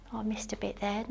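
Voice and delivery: whispered under her breath; a hushed note to self while cleaning; whispered aside. Real, not acted. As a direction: mutter it to yourself, hushed.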